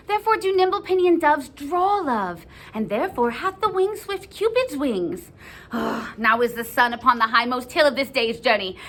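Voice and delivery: put-on lovelorn girl's eager soliloquy; lively, theatrical verse delivery; impatient Juliet. Reads Juliet's soliloquy in character.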